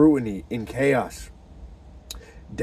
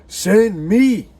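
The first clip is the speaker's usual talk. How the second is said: higher, louder cry of send me; rising call; 9 semitones higher, 9 dB louder than usual. Shown as a demonstration. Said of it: "Whom shall I send?".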